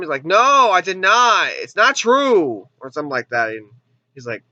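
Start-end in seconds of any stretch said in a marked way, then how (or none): 0.3-2.7 drawn-out, hammed-up protest; imitating an overwrought movie denial; mock dramatic denial. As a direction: stretch each word in melodramatic protest. Re-enacts the famous rooftop denial.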